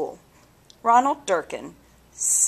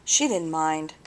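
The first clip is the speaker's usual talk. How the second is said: plain reading voice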